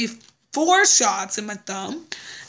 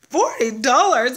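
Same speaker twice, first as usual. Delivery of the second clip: louder, slow "Forty dollars?" falling with glee; louder falling "Forty dollars?" full of relief; delighted exclamation; 2 semitones higher, 5 dB louder than usual. Retold or imitated. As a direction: land "Forty dollars?" as happy news. Retold delight at a low bill.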